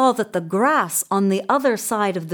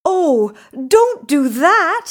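loud, high goat plea; raised creature voice, pleading; middle-sized goat; 10 semitones higher, 6 dB louder than usual. A narrator's reading. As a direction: cry out as a medium-sized goat. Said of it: "said the middle-sized Billy Goat Gruff".

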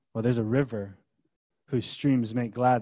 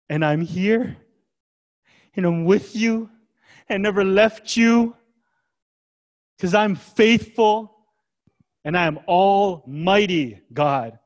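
building reassurance; 7 semitones higher than usual. Pretend. God reassuring, rising in force.

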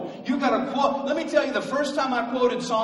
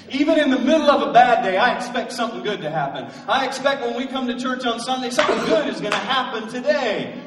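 excited; 4 semitones higher, 6 dB louder, faster than usual. Real, not acted. Preacher building excitement.

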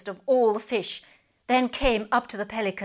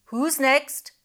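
calling out; 5 semitones higher, 4 dB louder than usual. Acted. Pelican calls out.

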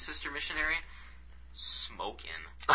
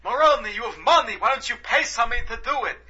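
high, loud put-on old man bickering; 12 semitones higher, 7 dB louder than usual. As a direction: ham up a kvetching old neighbour.

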